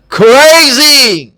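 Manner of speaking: کریزی stretched slowly, z sound clear; slow model word. Shown as a demonstration. Teacher modelling the z sound slowly. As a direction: say کریزی slowly so learners hear the z. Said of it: "Related words with their sounds".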